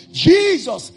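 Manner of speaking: joyful cry. Acted. Acted grateful morning prayer.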